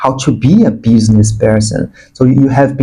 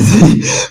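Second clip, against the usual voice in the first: short, breathy excited giggle; excited laugh; 6 semitones higher than usual. Real, not acted. Reader laughing at getting too excited, excited giggle. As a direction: giggle at getting carried away. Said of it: "I'm getting too excited".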